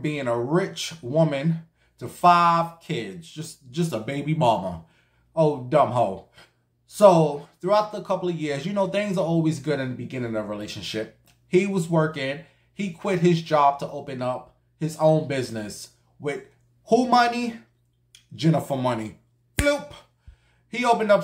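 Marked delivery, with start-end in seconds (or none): none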